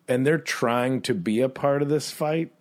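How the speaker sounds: ordinary talk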